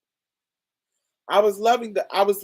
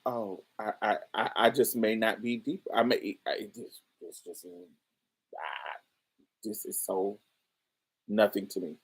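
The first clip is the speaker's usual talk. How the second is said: quiet inner thought; 5 semitones lower, 7 dB quieter than usual. Retold or imitated. Retold inner confused thought.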